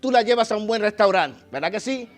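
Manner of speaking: loud, high sermon push; rally the congregation from the pulpit; full-force preacher; fervent preaching. Acted.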